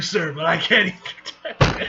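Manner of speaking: loud, slowing as laughter takes over; breaking into laughter. Real, not acted. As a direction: crack up while reading the line. Reader breaks into laughter mid-line.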